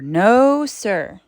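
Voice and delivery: ordinary delivery, nothing marked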